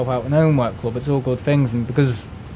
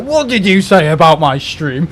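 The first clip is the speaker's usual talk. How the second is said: louder wrestler-style menace; Undertaker threat; 3 semitones higher, 6 dB louder than usual. Retold or imitated. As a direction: channel the Undertaker calling someone out. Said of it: "like the Undertaker of W W E".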